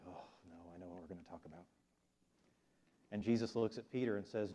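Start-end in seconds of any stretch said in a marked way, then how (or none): 0.1-1.6 very soft, low aside; dreading inner thought. Retold or imitated. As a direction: think it to yourself with quiet dread. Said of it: "I'd be thinking".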